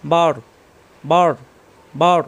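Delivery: বর with a heavy, full r at the end; thick ra. Shown as a demonstration. Teacher drilling ra sakin, বর with a thick r. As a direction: thicken the r closing each বর. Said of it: "র সাকিন র মোটা হবে".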